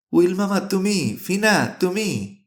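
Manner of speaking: high, slow, drawn-out disbelieving questions; stunned. A narrator's reading. Nephew suddenly meets uncle and Fina, stunned. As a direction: stretch each question out in astonishment. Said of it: "Godfrey স্তম্ভিত".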